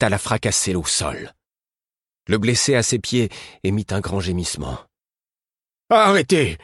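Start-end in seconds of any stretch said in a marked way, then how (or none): 5.9-6.6 high, loud sharp cry; a fat man crying out in alarm; exclaiming. A narrator's reading. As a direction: shout it to stop her. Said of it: "s'exclama l'homme corpulent".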